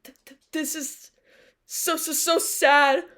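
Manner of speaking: mock stuttering actor. Mocking actors' added stutters, slow and overdone. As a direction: ham up a stuttered sad line. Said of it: "so they go".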